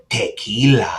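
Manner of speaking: put-on tequila in an overdone Spanish accent. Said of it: "they add an, a, like, a accent".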